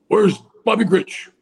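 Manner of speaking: high, slow put-on voice of the old president looking around; imitating Nixon. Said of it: "You know, like that".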